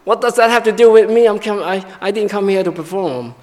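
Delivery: put-on reluctant child. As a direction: pout like a child dragged out to play piano. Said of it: "And they'll say".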